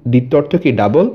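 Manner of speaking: usual speaking voice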